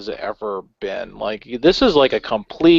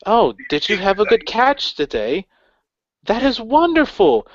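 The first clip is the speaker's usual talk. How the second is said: talking to a dog; 10 semitones higher than usual. Retold or imitated. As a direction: coo it like praising a pet. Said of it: "she'll say it the way, like, a person talks to, like, a dog".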